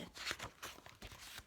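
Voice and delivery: quiet startled gasp; sharp gasp. Acted. Gerald surprised by the end, startled gasp.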